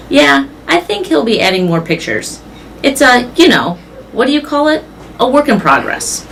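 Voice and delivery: a hint of a showman searching for words; Mac on the phone. Mac bragging to the caller about the billboard, lightly voiced.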